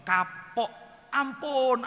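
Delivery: retold begging. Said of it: "dan kamu baru ngomong".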